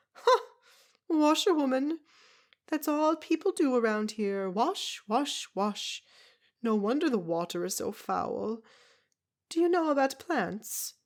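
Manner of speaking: put-on a tiny old foreigner scoffing at washerwomen; brusque foreign woman. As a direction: Zelda mocking the town's washing, loud and brusque. Zelda's voice held.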